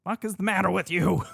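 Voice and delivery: high-pitched comic indignation, breaking into a laugh; playful exasperated scolding; mock outrage. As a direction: pretend to be appalled, pitch jumping up.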